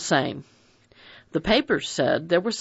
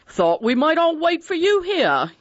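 high, loud hearty bluff voice; bluff colonial man; 12 semitones higher, 4 dB louder than usual. A narrator's reading. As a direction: boom it out like a jolly colonial.

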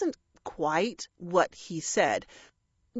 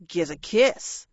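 slow, cheeky crow call; shouted; 3 semitones lower than usual. A narrator's reading. Crow inexplicably to the dragon, mild shout. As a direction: call it out slowly. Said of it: "one of the other crows shouted".

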